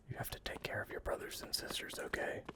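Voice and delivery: soft, fast whispered goodbye; hushed tender whisper to pets. Real, not acted. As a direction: whisper it like a secret farewell to little ones. Tender farewell to the creatures.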